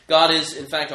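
loud, stressing God; emphatic declaration. Real, not acted. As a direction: land the word God hard. Emphatic preaching claim.

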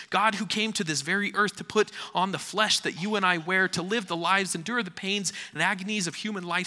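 high, loud, fast sermon climax; fiery preaching. Acted. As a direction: proclaim it with full force. Preaching at full force.

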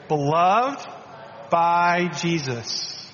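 slow, deliberate affirmation, by drawn out; slow declaration. Shown as a demonstration. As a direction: say it slowly as a confession of faith. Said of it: "so so just say".

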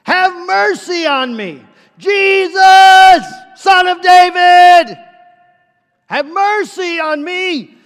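full-force high, slow holler; screaming. Acted. Blind beggar begging Jesus for mercy, screaming. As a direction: scream the plea out, stretching each word. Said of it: "He's screaming".

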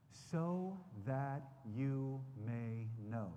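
each word drawn out very slowly; slow, deliberate repeat. Shown as a demonstration. Preacher repeating the verse to his listeners, very slowly. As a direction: let the phrase sink in, word by word. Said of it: "I want you to look at verse eighteen".

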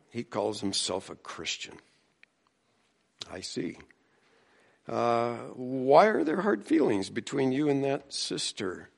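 normal talking voice

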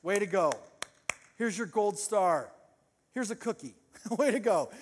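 mock congratulations, spaced out; very sarcastic. Shown as a demonstration. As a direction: hand out praise as if to a child. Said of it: "in a very sarcastic voice".

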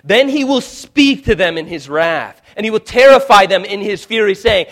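loud, rising pulpit thunder; fiery sermon delivery; preaching at full force. Acted. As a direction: build the call to a roar.

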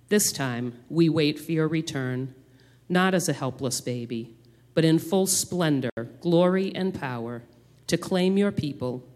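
solemn, measured public prayer; praying aloud. Acted. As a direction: lead the church in earnest prayer. Leads congregational prayer.

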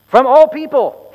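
exasperated oracle shout. Acted. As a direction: snap it out, fed up with them. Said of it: "clearly exasperated".

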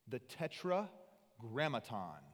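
slow, deliberate unveiling of TETRAGRAMMATON; big-word reveal. Shown as a demonstration. Preacher unveiling a huge Hebrew word, slowly. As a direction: stretch out TETRAGRAMMATON part by part. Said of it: "huge word incoming, you ready?".

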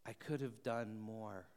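soft, hushed confession; very low voice. Retold or imitated. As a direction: say it barely above a breath. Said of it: "in a voice so low".

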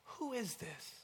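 soft, high, awed question; hushed wonder. Shown as a demonstration. Preacher showing the second way to ask, in wonder. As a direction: ask it softly in amazement. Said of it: "out of wonder".

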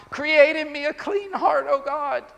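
high, pleading cry to God. Acted. Repentant king praying to God, crying out. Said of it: "he's crying out to God".

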